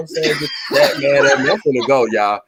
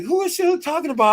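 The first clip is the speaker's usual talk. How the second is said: surprised; 3 dB quieter than usual. Retold or imitated. Retold alarm at the live stream.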